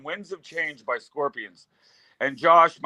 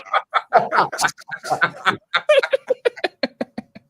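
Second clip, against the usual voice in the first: drawn-out laughter, higher pitched; long laugh; 4 semitones higher, 3 dB louder than usual. Real, not acted. Guest reaches his punchline, long helpless laugh.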